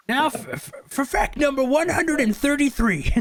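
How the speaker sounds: high, stammering know-it-all voice; stuttering high-pitched lecturer; fact-reciting bore. Acted. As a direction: announce trivia in a high put-on voice. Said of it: "back over at Peg".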